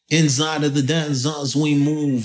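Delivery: imitating a Jamaican sound-tape host; weird cartoon announcer. Rapper imitating the guys on old sound tapes, cartoon announcer voice. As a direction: put on a cartoonish dancehall MC voice. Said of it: "like weird cartoon characters".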